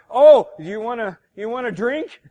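loud, high, teasing "Oh, you wanna drink?"; playfully change the subject, hammed up; sudden bright mock offer of a drink; mock sly offer. Woman mockingly diverting Jesus.

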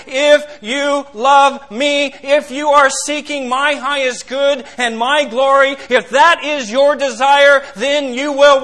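slow booming pulpit proclamation; preaching force. Acted. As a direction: proclaim it slowly, full voice. Quoting Jesus' command, preaching.

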